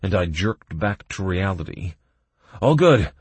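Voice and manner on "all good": loud, high reassuring shout. Acted. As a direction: call it out across the fight. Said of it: "I shouted".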